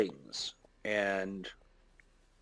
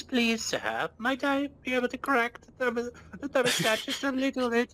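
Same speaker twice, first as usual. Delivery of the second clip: very high timid request; high pleading voice; 11 semitones higher than usual. Retold or imitated. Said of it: "It was".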